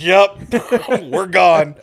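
laughing. Retold or imitated. Fleeing the angry major, laughing.

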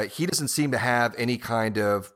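ordinary talk

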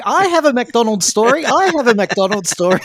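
high, loud mock-eager chant of the listeners. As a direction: play every listener bursting to share, laughing through it. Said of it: "all the listeners are going".

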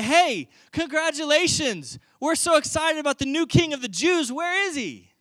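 high-pitched, eager congratulations; excited wise men. Acted. Wise men arriving at the king's house, excited. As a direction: gush with celebratory excitement, pitched well up.

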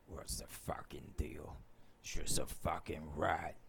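low gravelly thug voice, hushed; deep gravelly voice. Acted. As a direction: growl it low and rough under your breath. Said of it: "very deep, gravelly voice".

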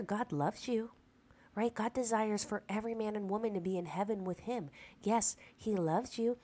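ordinary delivery

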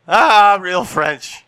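mock the Real Monsters ad as Orson Welles; Orson Welles bit. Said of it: "it was Orson Welles in that commercial".

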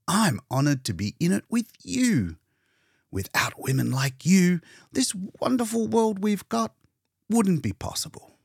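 grand praise, a touch hammed; Hitz's voice. Hitz praising Leora.

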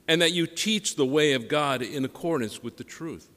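solemn, measured liturgical reading; Gospel reading. Acted.

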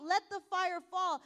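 retold shout. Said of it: "as they would shout".

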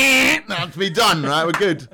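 loud, slow, satisfied builder; lazy workman voice. Retold or imitated. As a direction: slowly play the builder calling it a day. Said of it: "and went".

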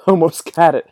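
breaking into laughter as you say it; laughing. Real, not acted. Laughing mid mock chase.

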